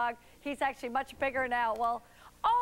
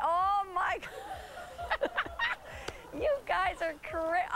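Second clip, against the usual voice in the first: laughing; 5 semitones higher than usual. Real, not acted. Host cracking up at a joke clip.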